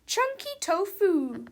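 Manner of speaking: high, loud teacher voice; stern teacher. A narrator's reading. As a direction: announce it over a noisy classroom. Acted teacher addressing her class.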